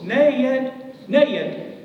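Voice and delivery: high, loud calls of "Ned!" in two syllables, fading on the last words; wife summoning Ned to the phone, high and loud; calling across the house. Retold or imitated. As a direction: yell for him from the kitchen. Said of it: "it's two syllables".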